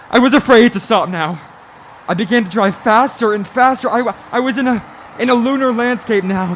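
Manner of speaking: a man losing his nerve at the wheel; panicky rising fear. Acted. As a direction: pitch climbs, words tumbling in fear. Panicked driver narrating fear.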